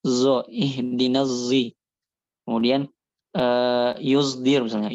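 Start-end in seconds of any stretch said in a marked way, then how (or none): 0.1-1.7 Zo, ihdinas zi said very slowly, sad blended with zai; slow sad-zai demo. Shown as a demonstration. Teacher demonstrating the reading: slow blended sad. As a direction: draw out ihdinas zi with the mixed sound. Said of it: "jadi kayak gitu bacanya".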